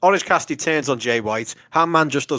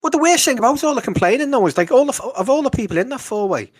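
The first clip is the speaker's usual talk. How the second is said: exasperated rant; 10 semitones higher than usual. Real, not acted. Exasperated rant about fan complaints.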